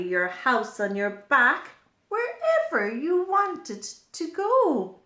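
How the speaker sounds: put-on a cheerful snail asking the children; snail character. Snail speaks in the book.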